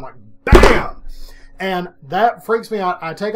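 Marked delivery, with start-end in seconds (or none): 0.4-1.0 explosive, shouted "bam"; really loud bang. Retold or imitated. Storyteller imitating another louder thump, explosive shout. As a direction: slam the word out as a sudden crash. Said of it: "a really loud one this time".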